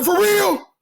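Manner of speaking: high-pitched surprised outburst; astonished disbelief. Retold or imitated. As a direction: imitate a man amazed by the coincidence. Acting out seller's astonished reaction.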